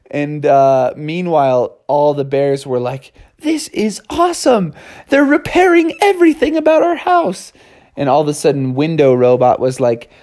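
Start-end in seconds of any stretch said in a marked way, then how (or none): 3.4-7.5 delighted. Acted. Bears delighted by the robots.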